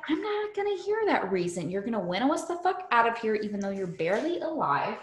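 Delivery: rapid bossy orders; fast bossy rant. Retold or imitated. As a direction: rattle it off. Bossy retold command.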